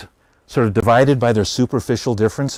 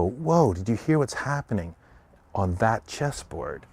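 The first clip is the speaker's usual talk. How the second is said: soft, slow, secretive; hushed awe; 7 dB quieter than usual. Retold or imitated. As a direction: lean in and pass on the rumor. Other chessboards gossiping in awe.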